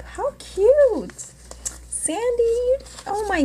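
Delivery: high gushing coo; squeal of delight. Real, not acted. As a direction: call her name like a happy squeal. Delighted by spider paper clip.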